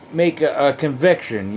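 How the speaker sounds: ordinary delivery, nothing marked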